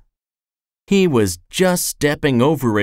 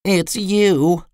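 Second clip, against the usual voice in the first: gloomy; 5 semitones higher than usual. A narrator's reading. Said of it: "he said gloomily".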